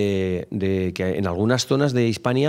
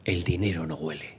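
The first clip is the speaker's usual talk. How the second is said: much lower, softer translation; hushed gloss; 7 semitones lower, 8 dB quieter than usual. Shown as a demonstration. Speaker explains the Latin in a low aside. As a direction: drop the voice to explain. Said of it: "translation after Latin phrase".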